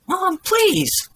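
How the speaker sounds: high-pitched, loud plea; pleading son. A narrator's reading. Son begs his mother to stop, high-pitched pleading. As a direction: beg mom to stop, high-voiced.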